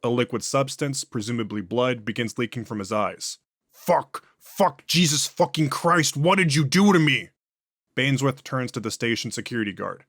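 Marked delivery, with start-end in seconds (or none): 3.7-7.3 loud, higher cries of agony; panicked shouting while convulsing; pained cursing. Acted. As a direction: curse through sudden burning pain. Convulsing, bleeding from eyes.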